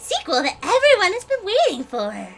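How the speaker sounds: high, animated announcing voice; showy game villain. Acted. Villain unveiling the latest game entry, high and showy. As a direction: proclaim it like a gleeful showman. Reads game dialogue in character.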